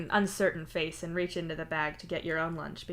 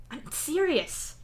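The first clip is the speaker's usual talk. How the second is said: slow, high, insistent; insist, drawing it out; 10 semitones higher, 3 dB louder, much slower than usual. Acted. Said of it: "you insist".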